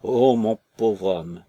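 drawn-out mournful cry over her husband; grieving woman wailing on the jetty; lament. A narrator's reading. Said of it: "sa lamentation".

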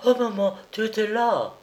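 a startled little cry to her mother; stifled cry. Acted. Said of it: "elle eut un cri étouffé".

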